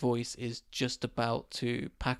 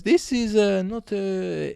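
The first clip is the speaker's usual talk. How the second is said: loud, high mock Indonesian driver's accent; uncertain foreign accent; 6 semitones higher, 8 dB louder, much slower than usual. Taxi driver challenging British passenger, high unplaceable mock accent. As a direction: put on the driver's unplaceable accent, pitched up and halting. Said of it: "don't know what accent that was".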